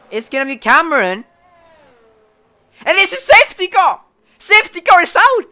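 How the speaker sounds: high, loud safety car call; excited shout. Real, not acted. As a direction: shout the crash as it happens. Crash, safety car called.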